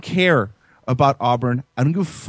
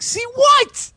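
baffled. Retold or imitated. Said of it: "I'm like".

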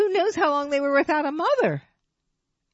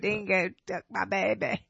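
put-on light Australian accent, low; a passing try at Australian; 4 semitones lower, 5 dB quieter than usual. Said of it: "let me hear your Australian accent".

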